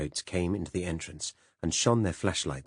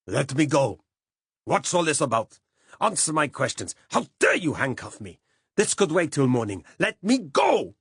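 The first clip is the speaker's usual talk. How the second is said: much higher, much louder furious shouts; angry shouting; 10 semitones higher, 7 dB louder than usual. A narrator's reading. Rolf fighting the police on the stairs: furious shouting. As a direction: yell your protests at the arresting cops. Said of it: "Rolf's angry shouting".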